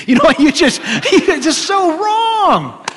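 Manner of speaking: high, loud, laughing "so wrong". Real, not acted. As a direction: crack up at how absurd it is. Laughing exclamation.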